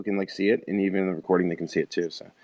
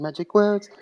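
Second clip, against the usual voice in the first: normal talking voice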